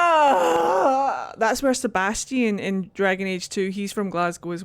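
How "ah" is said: loud high squeal of joy; excited cry. Real, not acted. Streamer squealing at a Glasgow voice actor. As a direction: cry out in delight.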